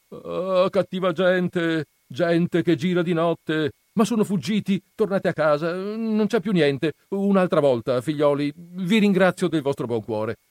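nervous priest calling down to the crowd; priest at the window. Acted. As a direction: send the crowd home, soothing but loud. Don Abbondio dismisses the crowd.

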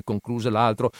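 ordinary delivery

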